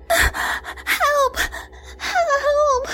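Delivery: very high squealed cry for help; shrieked. A narrator's reading. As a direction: shriek in panic. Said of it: "Jessie shrieked".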